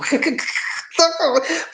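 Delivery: sudden high burst of laughter; high real laugh. Real, not acted. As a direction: laugh out at the foolishness. Laughing at the fool.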